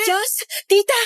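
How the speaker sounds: ordinary delivery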